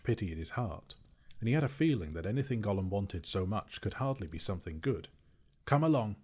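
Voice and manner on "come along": loud, high summons; short raised shout. A narrator's reading. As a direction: call out impatiently. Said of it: "he shouted".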